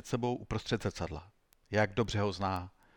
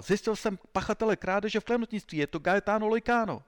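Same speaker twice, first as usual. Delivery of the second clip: high-pitched, elated announcement; triumphant; 10 semitones higher, 3 dB louder than usual. A narrator's reading. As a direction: proclaim the culprit proudly to the commissioner. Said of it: "přiběhl vítězoslavně na komisařství".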